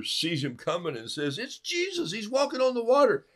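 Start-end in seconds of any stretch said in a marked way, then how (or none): 1.4-3.3 high, eager exclaiming; excited Peter. Retold or imitated. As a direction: bubble over with excitement.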